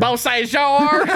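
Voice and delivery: slow, high Texas twang on Beausejour; exaggerated Texas twang; Texas accent. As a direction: drawl Beausejour out like a Texan. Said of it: "your best Texas accent of that pizza place".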